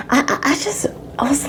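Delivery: whispered, breathless with awe; whispering in wonder; hushed whisper. Real, not acted. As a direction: drop to a whisper. Lost for words, hushed.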